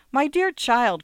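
high, loud, excited cry; stimulated excitement. A narrator's reading. Wife cries out to the secretary, stimulated excitement. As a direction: exclaim it, pitched right up. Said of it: "cried the wife with stimulated excitement".

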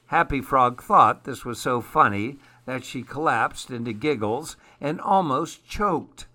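usual speaking voice

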